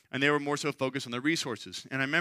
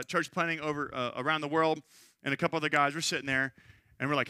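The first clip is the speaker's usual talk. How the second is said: ordinary talk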